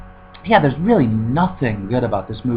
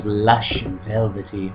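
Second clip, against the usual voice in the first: slow, hushed, savoring read of "Lush and velvety"; mock sultry review quote; 3 semitones lower, 4 dB quieter, slower than usual. Quoting a gushing review to viewers, slow mock-sultry. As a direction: drawl "Lush and velvety" out like ad copy. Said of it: "IndieWire says".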